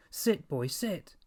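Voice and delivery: begging the dog in fear; choking panic. A narrator's reading. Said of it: "his voice choking with panic".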